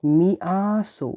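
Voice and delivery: মিয়াসো said slowly, syllable by syllable; drawn-out মিয়াসো, like a teacher modelling it; slow model word. Shown as a demonstration. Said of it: "মাংসকে ডাকা হয়". (ধীরে ধীরে মিয়াসো উচ্চারণ)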